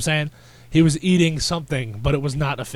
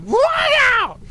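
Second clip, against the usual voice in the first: loud falsetto screech, stretched out; very high, loud mock holler; imitated taunting yell; 5 dB louder than usual. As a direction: mimic a hysterical hype man. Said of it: "like that, like".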